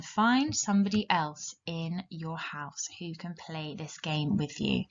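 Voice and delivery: normal talking voice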